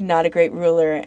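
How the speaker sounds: ordinary talk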